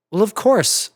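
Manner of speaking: plain reading voice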